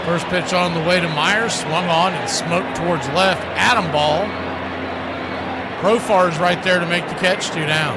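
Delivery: play-by-play call. Acted. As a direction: call the pop-up and the catch.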